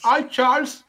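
mock someone Americanizing their name; mock gringo accent. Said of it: "quiera hacerse el gringo".

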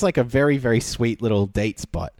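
normal talking voice

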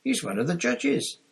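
high, scandalised protest; indignant lift in pitch; shocked disapproval. A narrator's reading. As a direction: point it out, appalled. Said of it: "looking very shocked".

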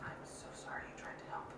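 whispered apology; breathy aside, barely voiced. Real, not acted.

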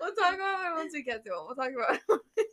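words squeezed out through giggles; real laughing. Real, not acted. Host moving on from forgotten notes, helpless laughing. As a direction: try to carry on while cracking up. Trying to move on while laughing.

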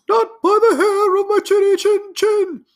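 very high squeaky pig voice, loud; little pig shouting at the wolf in a tiny squeal. Acted. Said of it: "if you want the better voices".

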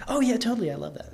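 high, bubbly imitation of eager agreement; mock gushing. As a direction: play up the fake enthusiasm. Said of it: "responses that were more like".

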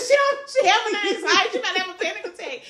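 begging Angel to help her panicking cousin; high urgent plea. Retold or imitated.

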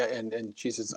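ordinary talk

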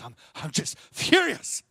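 slow, held-in "I'm just furious"; mock furious. Shown as a demonstration. Preacher acting out the top of anger, mock furious. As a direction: grit it out, slowly. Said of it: "we might tip into furious".

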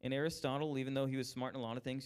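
ordinary talk, nothing marked

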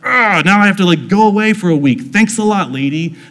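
hammed-up annoyed brush-off; mock frustration. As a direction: gripe with sarcastic thanks, fed up. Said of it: "expecting him to be frustrated and be like".

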